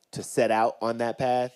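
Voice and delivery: ordinary talk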